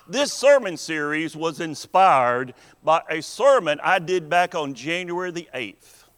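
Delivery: ordinary talk, nothing marked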